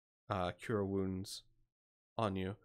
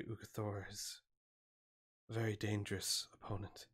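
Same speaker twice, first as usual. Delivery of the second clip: a quiet, measured word of warning; cautioning cleric; 5 dB quieter than usual. Acted. Cleric warning adventurers before they go down, quietly measured.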